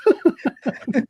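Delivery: high burst of laughter; big laugh. Real, not acted. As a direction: crack up at your own joke. Laughs at own joke.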